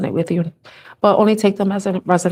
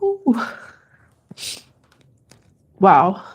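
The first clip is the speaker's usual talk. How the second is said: fighting tears. Real, not acted. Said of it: "I don't like crying on camera".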